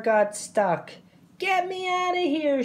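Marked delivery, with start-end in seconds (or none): 1.4-2.6 high-pitched storybook holler; holler for help. A narrator's reading. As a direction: cry out as if stuck fast, pitched high. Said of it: "she hollered".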